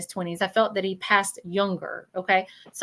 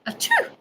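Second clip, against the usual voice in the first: very high mock sneeze; imitated sneeze. Medium showing how a spirit sneezed at a feather, mock sneeze. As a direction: squeak out a pretend achoo. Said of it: "And he went".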